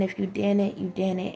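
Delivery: ordinary delivery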